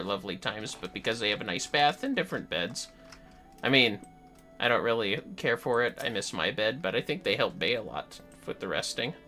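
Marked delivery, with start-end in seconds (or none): none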